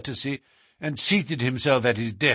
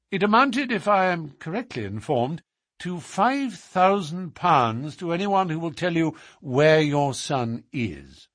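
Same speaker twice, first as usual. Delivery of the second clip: lightly voiced detective, pitched up; formal, assured Holmes; 7 semitones higher, 4 dB louder than usual. Holmes asks the Duke to confirm the reward, formal and assured. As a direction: state the case formally in a higher register.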